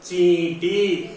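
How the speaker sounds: चींटी stretched slowly for pupils to repeat; drawn-out चींटी with long nasal vowel; slow model word. Shown as a demonstration. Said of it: "बोलो".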